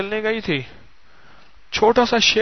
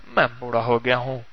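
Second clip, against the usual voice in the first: low, soft admission of age; weary old king; 6 semitones lower, 7 dB quieter than usual. Acted. Old king hands his son the throne, low and weary. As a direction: let the voice sink, lightly acted.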